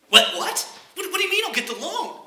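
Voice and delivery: high, disbelieving what-what; stunned customer who can't believe it; incredulous surprise. Acted. Customer to bank president, high stunned disbelief.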